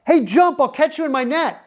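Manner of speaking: high call from the boat, then it drops away; mock yell up. Man in the boat yelling up to the hanging guy, pitched high. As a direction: throw the words up to the man on the cliff. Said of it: "he yells up".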